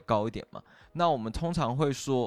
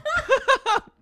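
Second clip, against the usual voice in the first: high laugh; 8 dB louder than usual. Real, not acted. Laughing at slow recording.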